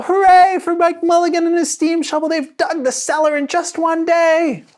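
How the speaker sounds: high, loud celebrating townsfolk; crowd cheering. A narrator's reading. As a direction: shout their joy to the digger. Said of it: "shouted the people".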